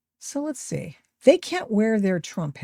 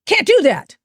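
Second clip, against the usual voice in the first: mocking critics; 7 semitones higher, 7 dB louder than usual.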